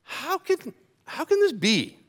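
high, incredulous disbelief; baffled question. Acted. Nicodemus asking Jesus, high and baffled. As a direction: ask it like the idea makes no sense. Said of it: "and he goes".

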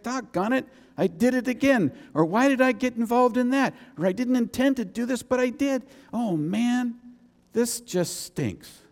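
high-pitched exasperated dismay, sinking at the end; wretched self-reproach. Acted. Preacher voicing a believer who sinned again, wretched self-reproach. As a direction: kick yourself aloud, then slump. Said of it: "you know you feel wretched about it".

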